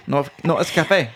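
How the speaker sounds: usual speaking voice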